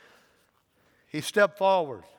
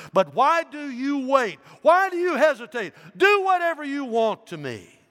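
defiant cry; 14 semitones higher, 9 dB louder than usual. A narrator's reading. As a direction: dare them to do their worst. Polycarp's climactic defiance.

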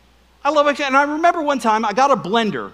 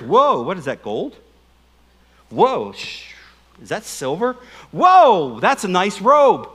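mock greedy wonder; 4 dB louder, slower than usual. Achan marveling at loot.

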